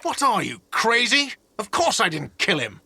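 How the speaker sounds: high-pitched indignant denial. Acted. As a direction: protest the accusation as absurd. Indignant denial when suspected of killing.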